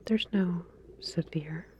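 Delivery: usual speaking voice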